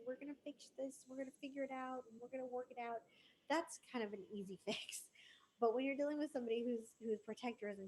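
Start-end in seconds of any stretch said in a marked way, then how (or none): 0.1-3.0 higher, softer comforting tone; comforting reassurance. Retold or imitated. Soothing someone whose child is in charge, gentle. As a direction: rush in to soothe. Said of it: "most people would run in and go".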